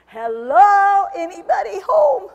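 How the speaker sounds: loud, high "hello, anybody home?"; mock shout. Preacher mocking deceived believers, loud and high. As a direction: call it out as if knocking on an empty head. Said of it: "You're like".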